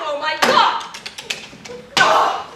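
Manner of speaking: ordinary delivery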